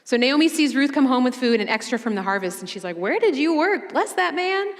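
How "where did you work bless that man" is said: high, eager questions and gushing blessing; excited mother-in-law. Retold or imitated. Naomi hearing about Ruth's day, high and excited. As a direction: pitch it up like a thrilled mom.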